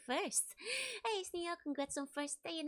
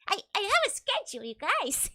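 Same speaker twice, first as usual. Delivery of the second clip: mock indignant; 6 semitones higher, 7 dB louder than usual. Mock protest to chat.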